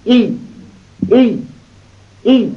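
ای sounded out, rising high; syllable ای repeated. Shown as a demonstration. Teacher modelling ای for Quran pupils. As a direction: model ای clearly for learners.

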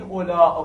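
long ā and glottal stop at the end of اولا. Shown as a demonstration. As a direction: stretch اولا and close with hamza. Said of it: "با حالت مد تلفظ بکنید". («اولا» با مد و همزه)

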